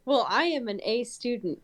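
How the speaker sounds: normal talking voice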